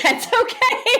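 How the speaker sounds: high, slow, through laughter; real laughing forgiveness; laughing reassurance. Real, not acted. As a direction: squeal it while cracking up. Laughing at host's whispered confession.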